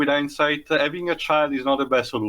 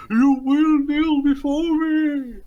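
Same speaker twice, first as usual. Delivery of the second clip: much higher, slow villain command; General Zod; 13 semitones higher, 4 dB louder, slower than usual. Retold or imitated. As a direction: drag out a grand villain order. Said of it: "was acting like General Zod".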